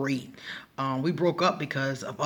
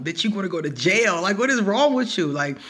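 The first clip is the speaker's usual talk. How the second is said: higher and louder, scolding roommate; mock outrage; 6 semitones higher, 4 dB louder than usual. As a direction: play the appalled roommate. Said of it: "So she was like".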